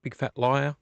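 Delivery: ordinary talk, nothing marked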